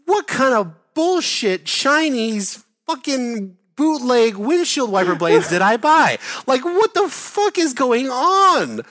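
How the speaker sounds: high, drawn-out indignant rant; indignant outrage. Retold or imitated. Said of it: "I start to get indignant".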